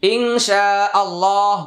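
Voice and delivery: ইনশাআল্লাহ slow, ইং nasal-hidden, শা long, আল্লাহ heavy; Quranic ইনশাআল্লাহ. Shown as a demonstration. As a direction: draw out শা and thicken আল্লাহ in ইনশাআল্লাহ. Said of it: "আল্লাহটা মোটা করে দিলেন".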